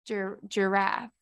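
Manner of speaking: GIRAFFE broken slowly after "Gir-"; testing two syllables. Shown as a demonstration. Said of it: "giraffe is two syllables".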